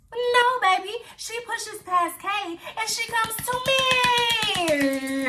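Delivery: high, drawn-out storytelling wail; hammed-up high-pitched shock, stretching the last words; dramatic reveal. Storyteller revealing the girl came straight for her, hammed-up and dramatic.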